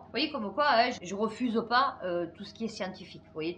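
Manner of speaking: usual speaking voice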